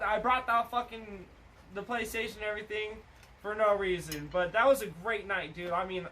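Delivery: ordinary talk, nothing marked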